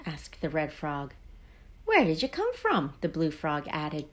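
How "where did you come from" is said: high-pitched, lightly acted curious question; mildly hammed-up wondering. A narrator's reading. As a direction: ask with wide-open curiosity, pitch jumping up. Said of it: "peering at him curiously".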